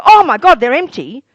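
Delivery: high and loud on "Oh my God", then it drops; play-acting alarm at empty cups; mock shock. Mock shock in a crockery shop.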